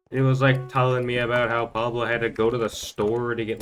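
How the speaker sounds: ordinary talk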